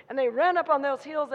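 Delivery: ordinary talk, nothing marked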